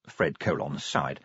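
plain reading voice

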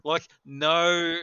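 usual speaking voice

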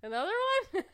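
high loud laughing cry; mock alarm through laughs; laughing squeal.

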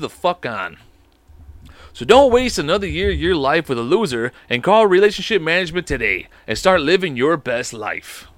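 punchy, overeager infomercial pitch; ad read. Acted. Host reading a fake sponsor ad to listeners, pushy salesman voice. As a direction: sell it like a cheesy late-night ad.

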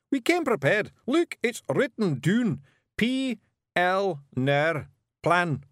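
high, loud Scots boast, slowing to spell out; proud Scottish Feegle. A narrator's reading. Rob showing Miss Level his written plan, proud. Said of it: "said Rob proudly".

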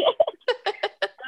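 a burst of high-pitched laughter. Real, not acted. Telling the podcast host her blunder, high laughing burst.

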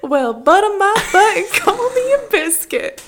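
put-on over-the-top country accent, cracking up; country western accent. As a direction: ham up a Southern folksy saying. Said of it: "Can you do a country western accent?".

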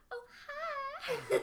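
imitating a high girly greeting; high feminine greeting. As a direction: chirp it up high and sweet. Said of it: "they all go".

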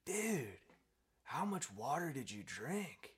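high, loud drawn-out disbelief; stoned astonishment. Acted. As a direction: draw out the punchline as an amazed stoner koala. Stoned koala's astonished punchline.

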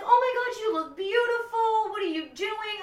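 high, loud, gushing voice; excited family on the phone. Retold or imitated. Sisters calling to rave about her look, high and gushing. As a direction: gush over her like thrilled family. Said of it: "and they're like".

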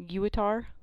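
"Guitar" as gyoo-uh-tar; odd pronunciation. Shown as a demonstration. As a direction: stretch Guitar into three syllables, gyoo-uh-tar. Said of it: "if they pronounced it like".

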